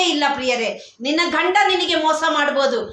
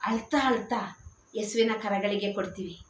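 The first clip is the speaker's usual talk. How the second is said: much softer, lower, as if in tears; weeping softly; 7 semitones lower, 10 dB quieter than usual. Acted. Preacher voicing believers weeping before Jesus, soft and tearful. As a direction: let the words sink into quiet sorrow.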